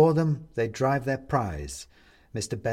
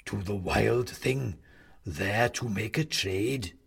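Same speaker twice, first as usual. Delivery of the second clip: slow, lower creepy clown voice; drawn-out menace from a headless toy; the clown answers its captive, slow and eerie; sinister goblin clown; 3 semitones lower, slower than usual. Acted. Clown character voice.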